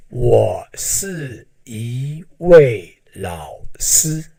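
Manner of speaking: ordinary talk, nothing marked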